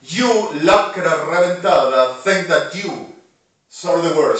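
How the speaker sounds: furious retold shout at the enemies; retold scream. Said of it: "we're screaming and saying to them".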